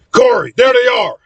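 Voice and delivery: high, excited alert; urgent call. Acted. Spotting the roaches and alerting Corey, urgent high call. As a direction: call out on a sudden sighting.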